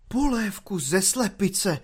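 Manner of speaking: slow, high-pitched disbelieving question; aghast, drawn-out echo; incredulous echo. A narrator's reading. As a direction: repeat it as if you can't believe your ears. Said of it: "opakoval po něm nadporučík, chytaje se za hlavu".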